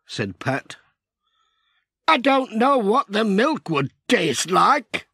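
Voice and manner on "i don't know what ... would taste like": Peter. A narrator's reading. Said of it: "said Peter".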